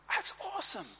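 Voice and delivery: a high, thrilled exclamation; mock thrilled high voice; excited. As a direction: squeal with delight. Excited at the news.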